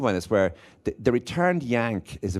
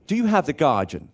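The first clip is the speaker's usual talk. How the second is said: higher, lightly put-on buyer's voice; a touch of showing off at the newsagent; newspaper buyer's line; 6 semitones higher, 4 dB louder than usual. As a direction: ask it grandly, pitched up. Acts out buying newspaper, posh.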